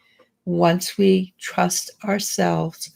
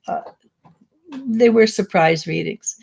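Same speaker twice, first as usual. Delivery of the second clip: ordinary delivery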